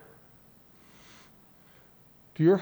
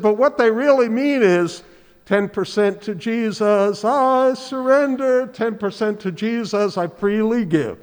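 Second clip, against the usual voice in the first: high, loud Southern preacher parodying a hymn; mock hymn in a Georgia preacher's accent; imitated Southern Georgia accent; 6 semitones higher, 7 dB louder than usual. Pastor as visiting preacher mocking half-hearted singers, loud Southern accent. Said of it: "all in that Southern Georgia accent".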